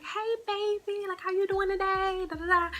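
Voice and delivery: high, sweet greeting voice; friendly owner's wife. Retold or imitated. Owner's wife greeting a regular customer, sweet and high. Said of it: "The wife saw me and she was like".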